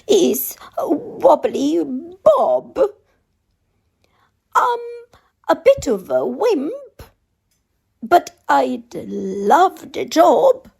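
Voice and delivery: high, timid little cook voice; wimpy cook asking for the job, voiced high. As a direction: pitch up into a nervous, meek cook. Cook introduces himself timidly.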